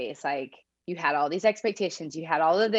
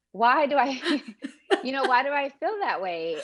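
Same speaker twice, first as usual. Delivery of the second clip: higher, half-laughing puzzled question; a hint of puzzled self; 7 semitones higher than usual. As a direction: ask yourself, bemused. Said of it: "you're going".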